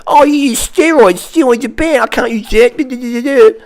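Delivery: high, whiny mock voice of a naive newbie; squeaky high sneering impression of a gym noob. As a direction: parody a clueless beginner, dragging the last words slowly. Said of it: "all the noobs out there go".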